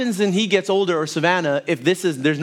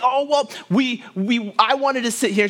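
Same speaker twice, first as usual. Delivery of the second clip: higher-pitched, lightly voiced little boy; whiny son; 7 semitones higher than usual. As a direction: play the boy pleading his case. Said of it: "And he's saying".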